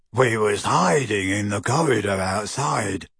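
low, dim-witted grunting henchman; grunting oaf. A narrator's reading. Henchman Goyle explains to Harry, grunting oafishly. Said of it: "grunted Goyle".

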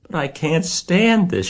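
high-pitched outburst; anguished shout. A narrator's reading. Wilbur can't stand losing Charlotte, anguished shout. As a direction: shout it out, voice shooting up. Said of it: "shouted Wilbur".

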